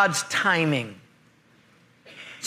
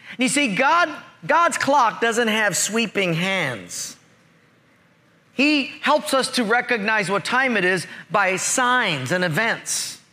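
ordinary talk, nothing marked

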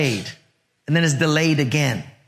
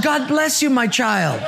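mock pastoral blessing; 8 semitones higher than usual. Pastor mock-blesses the airline agent.

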